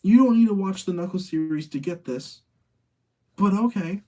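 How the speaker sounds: high, loud mock exasperation; a sarcastic fake-cheery okay; sarcastic aside. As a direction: toss it off like an unimpressed viewer rolling their eyes. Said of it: "it was like".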